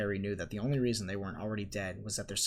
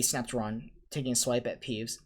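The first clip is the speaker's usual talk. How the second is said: ordinary delivery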